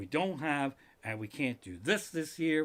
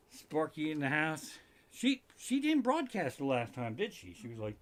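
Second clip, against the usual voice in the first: usual speaking voice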